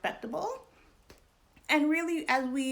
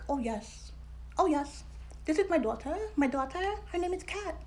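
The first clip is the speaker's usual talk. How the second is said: put-on father's British accent; 6 semitones higher than usual. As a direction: imitate dad putting on a queenly British accent. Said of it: "he just left Britain yesterday".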